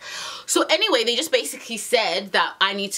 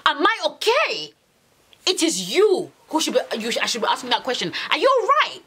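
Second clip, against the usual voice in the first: retold indignation, pitched up in bursts; indignant; 5 semitones higher than usual. Woman confronting boyfriend about her car, indignant. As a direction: re-enact an exasperated telling-off.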